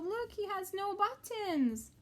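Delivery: ordinary delivery, nothing marked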